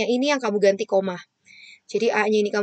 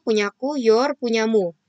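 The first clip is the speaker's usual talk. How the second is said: ordinary talk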